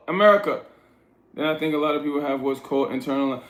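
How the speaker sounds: ordinary talk, nothing marked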